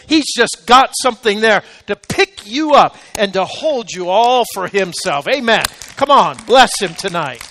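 loud, rising preacher's exhortation; impassioned sermon voice, pressing hard; full-force preaching. Acted. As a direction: preach it out, lifting the room.

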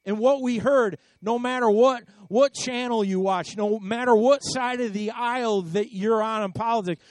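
ordinary talk, nothing marked

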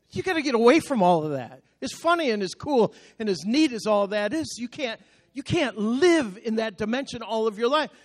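ordinary delivery

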